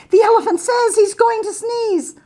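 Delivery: high warning call; calling bees. Acted. Bees call a warning to the bear, high. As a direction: call the alarm, pitched high. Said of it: "called the bees to the bear".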